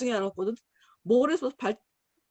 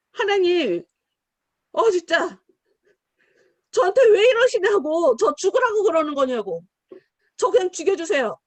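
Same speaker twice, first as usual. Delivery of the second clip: louder, higher, pleading outburst at God; angry complaint; 5 semitones higher, 6 dB louder than usual. Retold or imitated. Jonah shouting at God over the lost vine, angry and loud. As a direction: rage at God like a sulking prophet. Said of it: "막 화를 내면서".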